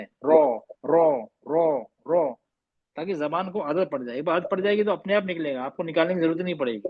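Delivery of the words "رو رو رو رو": رو repeated, heavy rounded o; drilling ro. Shown as a demonstration. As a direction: drill رو over and over, fading.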